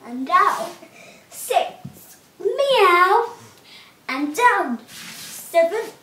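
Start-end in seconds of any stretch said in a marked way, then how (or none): 2.4-3.3 high rising meow; a light cat meow. Shown as a demonstration. Teacher counting cat sit-ups with a light high meow. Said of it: "cat sit-ups".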